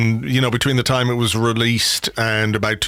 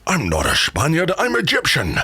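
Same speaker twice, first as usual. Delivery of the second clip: mock film-star retort, leaping high at the end; hammed-up Scottish actor's indignant correction; Sean Connery; 3 semitones higher than usual. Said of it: "And he goes".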